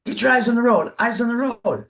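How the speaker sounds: high, mock child's bossy call; bossy little girl. Granddaughter nagging the driver from the back, high and bossy. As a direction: lightly play a toddler nagging from the back seat. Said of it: "the biggest pain in the butt".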